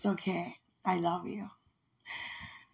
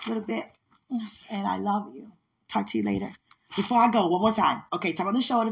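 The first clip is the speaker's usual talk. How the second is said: ordinary talk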